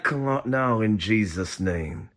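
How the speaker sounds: low, slow, still-lipped murmur; hushed ventriloquist's command. Shown as a demonstration. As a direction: speak without moving your lips. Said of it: "I've learned the art of ventriloquism".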